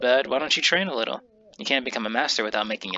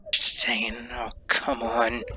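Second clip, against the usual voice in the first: annoyed whisper; much slower than usual. Acted. Character annoyed by noisy women.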